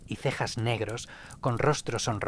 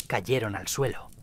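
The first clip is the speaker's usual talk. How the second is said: usual speaking voice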